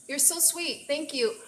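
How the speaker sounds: ordinary talk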